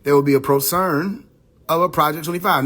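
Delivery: measured, solemn reading of a prophecy; prophetic reading. Acted. Reading his book's prophecy to viewers, solemn. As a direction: read it like scripture, each line weighed.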